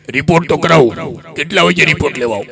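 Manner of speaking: put-on low, quick old villager voice; old man asking the doctor when to return.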